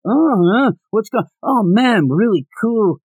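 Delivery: high, slow, spaced-out rambling; stoner teacher. Retold or imitated. Stoned teacher to the class, slow, high and spaced out. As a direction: drift through it like a stoner. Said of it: "he's like".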